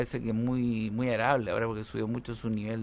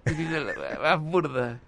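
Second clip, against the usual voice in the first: ordinary talk, nothing marked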